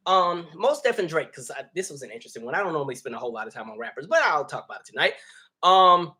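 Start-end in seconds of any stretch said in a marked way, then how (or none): none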